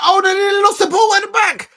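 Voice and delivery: sudden high yell of alarm; excited shout. Real, not acted. Fan yelling at his team's near mistake at the back, excited shout. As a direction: jump up and shout at the near miss.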